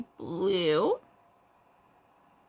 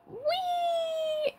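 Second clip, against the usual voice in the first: high squeal of delight; gleeful whee. Pretend. Teacher delighting toddlers with a surprise, squealing. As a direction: squeal like sliding down a slide.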